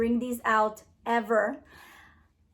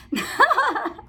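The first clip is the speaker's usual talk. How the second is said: high burst of laughter; real laugh; 10 semitones higher than usual. Real, not acted. Vlogger botching a channel name, laughing. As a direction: crack up at your own slip. Cracks up at her own slip.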